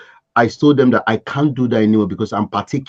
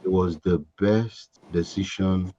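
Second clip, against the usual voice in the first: sincere; 5 semitones lower, 9 dB quieter than usual. Real, not acted. Soft sincere testimony.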